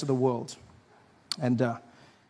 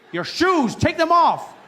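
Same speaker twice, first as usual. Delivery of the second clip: higher, louder mock order to take shoes off; barking security guard; 10 semitones higher, 6 dB louder, slower than usual. Security guard ordering a confused foreigner, mock loud bark. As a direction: bark it like an impatient airport guard. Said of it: "They're like".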